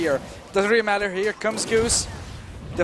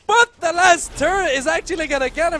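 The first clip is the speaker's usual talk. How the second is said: excited; 7 semitones higher than usual. Real, not acted. Casting a dive.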